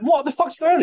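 very high-pitched disbelief; incredulous demand. Retold or imitated. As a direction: pitch shooting up in exasperated disbelief. Retold confrontation with venue owner.

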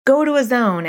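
put-on sending a child off, firm but light; parent instruction. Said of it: "I would say".

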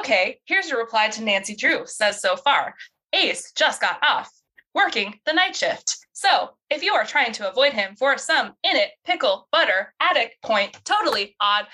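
stilted assistant, chopping words apart; phone AI assistant. Acted. Virtual assistant misreading Bess's reply back, stilted and mechanical. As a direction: read back garbled dictation in separate chunks.

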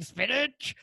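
high cartoon sailor voice; vintage cartoon voice. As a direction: do the Popeye spinach line. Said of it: "so I was like".

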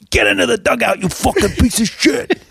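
hammed-up angry Mets fan; imitating a fan yelling. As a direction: yell at the field like a diehard fan. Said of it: "he's going".

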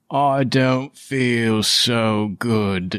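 slow, drawn-out queasy villain; about to puke. Acted. Villain hit with alcohol, slowly turning queasy. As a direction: stretch every word as nausea rises. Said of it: "like he's gonna puke".

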